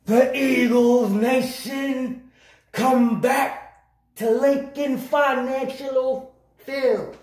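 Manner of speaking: slow, high, drawn-out hype call; announcer voice. Acted. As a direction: stretch it out like a stadium announcer.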